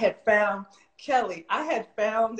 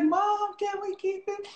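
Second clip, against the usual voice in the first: put-on high, pleading child's voice, much higher; pleading kid; 12 semitones higher than usual. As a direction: beg like a kid asking mom. Teen begging mom for pet.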